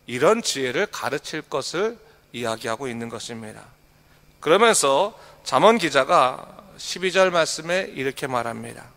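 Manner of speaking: ordinary talk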